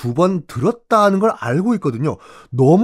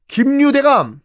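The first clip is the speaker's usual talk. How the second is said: calling out; 10 semitones higher than usual. Acted. Calling for the missing leader.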